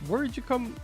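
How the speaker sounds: ordinary talk, nothing marked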